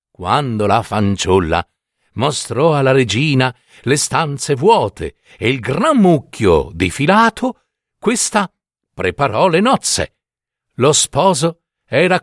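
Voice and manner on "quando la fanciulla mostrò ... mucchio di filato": ordinary delivery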